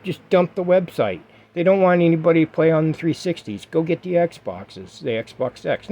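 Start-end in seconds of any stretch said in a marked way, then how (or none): none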